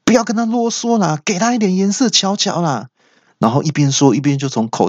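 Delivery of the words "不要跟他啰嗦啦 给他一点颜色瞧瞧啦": much higher, squeaky thug voice; third brother thug. Retold or imitated. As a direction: egg on the gang in a high whine. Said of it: "里面有个三哥就说".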